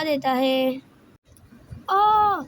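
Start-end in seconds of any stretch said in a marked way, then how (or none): 1.9-2.4 a sharp high "aah" of sudden pain; pained yelp. Acted. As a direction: yelp as the pin pricks you. Sonam pricked by pin. (चुभन से तीखी चीख)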